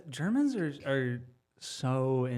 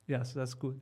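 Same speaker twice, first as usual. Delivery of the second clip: deadpan German impression, quieter and unlaughing; German person laughing; 2 semitones lower, 8 dB quieter than usual. Comic mimics an unamused German audience, deadpan and quiet. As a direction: play a stone-faced German who won't laugh. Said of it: "this is my impression of a German person laughing".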